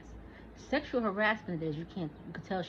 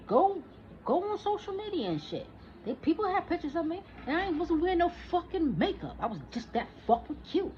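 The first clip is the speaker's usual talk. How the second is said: excited boast; 9 semitones higher than usual. Real, not acted. Boasting about old photos.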